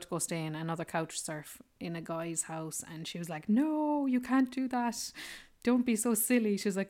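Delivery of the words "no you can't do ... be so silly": high, loud retold objection; mock protest. Girl telling her new friend off, high mock protest. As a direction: talk someone out of it, pitched up and louder.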